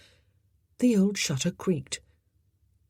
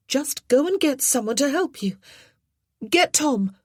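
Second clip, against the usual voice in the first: calling out; 9 semitones higher, 4 dB louder than usual. A narrator's reading. Jean calling through door.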